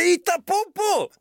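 high-pitched imitation of someone yelling; stretched, squealed heckle, far above normal pitch; mock scream. Someone screaming at people with phobias, high mock yell. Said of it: "Förrän någon skriker".

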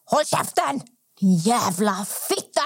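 imitated angry old man. Old man turning on the friend in a shop, imitated angry outburst. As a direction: play the old man cursing without real volume. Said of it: "stannar den här gubben upp och bara".

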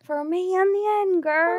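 high, slow mock Kerry; hammed-up Kerry lilt, pitched high; fake Kerry accent. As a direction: draw it out in bad Kerry. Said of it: "That's the worst Kerry accent".

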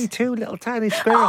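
high squeaky little squirrel voice; tiny squirrel voice. Pretend. Co-host joins in, playing a tiny squirrel in a high squeak. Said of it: "talk in a squirrel voice".

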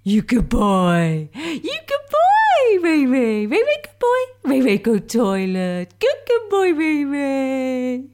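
high, drawn-out cooing to a pet; dog voice. Shown as a demonstration. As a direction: coo in swooping baby talk at your dog. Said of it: "do how we would address a dog".

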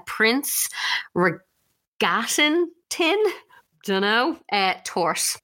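"Prince Regatten tin, torte" sounded out slowly in hesitant pieces; attempted German pronunciation. Real, not acted. Host trying the cake's German name for a co-host, halting attempted pronunciation. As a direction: feel your way through "Regatten tin", pausing before and after it. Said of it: "see if I can pronounce this right".

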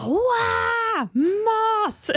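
high, thrilled cry; excited shout. Retold or imitated. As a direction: cheer at the sight of food. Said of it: "Och vi bara".

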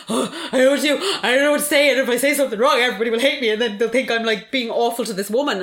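laughing mock panic; panicky self-quote. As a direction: play her own past panic, half laughing. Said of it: "'Cause I was like".

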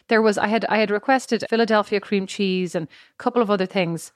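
ordinary talk, nothing marked

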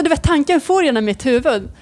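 ordinary talk

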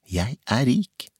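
lettere jublende tanke, høyere tone. A narrator's reading. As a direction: tenk det langsomt og gledestrålende, med stemmen opp. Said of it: "Han hadde jublende tenkt". (jublende glad)

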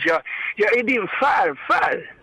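a hint of an old grandfather, slow and drawn out; slow, lightly put-on grandpa voice; lätt spelad farfar. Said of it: "du är bra för att köra röster". (lätt spelad farfarsröst, långsamt)

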